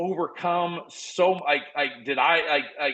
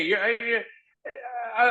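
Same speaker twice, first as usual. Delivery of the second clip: ordinary talk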